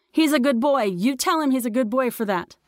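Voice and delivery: high, lightly voiced mother; mom calling praise. A narrator's reading. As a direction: call up praise for a child. Mom praising from car below.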